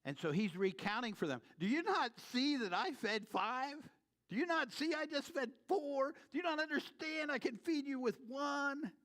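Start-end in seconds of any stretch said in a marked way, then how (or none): 1.6-8.9 higher, louder pleading questions; voicing Jesus. Jesus asking his disciples why they doubt, higher pleading voice. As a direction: press the disciples with each question.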